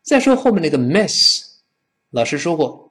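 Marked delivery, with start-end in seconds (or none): none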